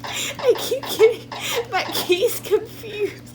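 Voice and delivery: high, slow words broken by laughing; helpless laughter. Real, not acted. Player excusing mistakes to teammate, laughing helplessly. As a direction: squeak the excuse out through giggles.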